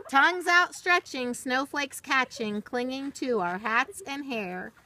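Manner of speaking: lilting, rhythmic storybook read; reading to children. Acted. Teacher reading a rhyming book to children, storybook lilt. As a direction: read the rhyme slowly to little listeners, sing-song. Reads picture book aloud.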